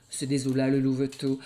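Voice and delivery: usual speaking voice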